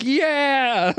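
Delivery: a sudden high, joyful yelp of relief; excited cheer. Retold or imitated. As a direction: cheer like your team just won. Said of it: "and I was like".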